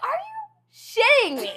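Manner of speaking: high, drawn-out disbelief; hammed-up squeal of indignation; incredulous outrage. Stung daughter answering her mom, high incredulous outrage. Retold outrage at mom's reply.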